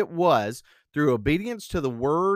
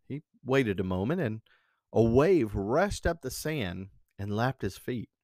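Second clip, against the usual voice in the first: usual speaking voice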